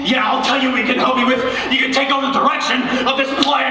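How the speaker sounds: fast shouting snap; exasperated. Acted. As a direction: bite his head off. Exasperated director snaps at intern.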